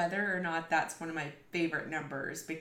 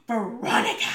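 loud high shocked call of the name; grandma snaps the child's name in shock; shocked scolding cry; 5 semitones higher, 11 dB louder than usual. Retold or imitated. Grandma scolding the child for her remark, shocked loud cry.

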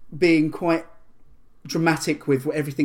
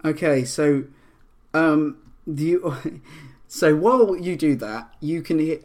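ordinary delivery

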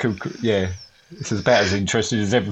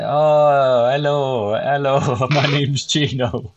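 drawn-out, singsong mock Italian; put-on Italian greeting, a laugh inside; fake Italian accent; 3 semitones higher, much slower than usual. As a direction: ham up a slow Italian accent. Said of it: "Go on, do his Italian accent".

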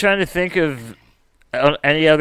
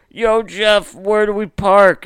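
mock call out; 7 semitones higher, 4 dB louder, slower than usual. Retold call from the car.

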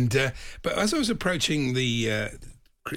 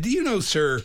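higher-pitched imitation American accent; 7 semitones higher, 4 dB louder than usual. Retelling an American stranger's approach, imitated American accent. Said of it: "I'm gonna do the accent".

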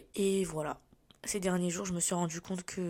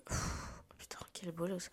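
unvoiced whisper, overwhelmed; whispering to herself; 8 dB quieter than usual. Real, not acted. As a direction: breathe the curse out under your breath. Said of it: "c'était trop pour moi".

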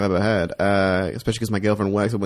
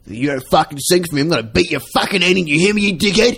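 shouted mock threats, rising higher and louder; swearing tirade; 10 semitones higher, 6 dB louder than usual. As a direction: yell it like a furious drunk bloke in a pub. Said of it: "Give us a tune right now".

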